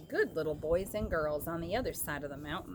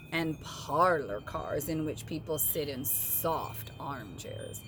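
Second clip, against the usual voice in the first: lower, slow, haughty engine voice; snooty engine; 3 semitones lower than usual. Acted. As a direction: scoff down at the little train. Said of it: "the shiny new engine snorted".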